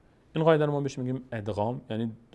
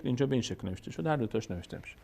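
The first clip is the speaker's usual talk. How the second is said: ordinary delivery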